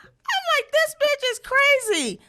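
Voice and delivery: slow, very high-pitched disbelief; exasperated squeal. Retold or imitated. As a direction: stretch it out in a high incredulous squeal. Exasperated retold reaction.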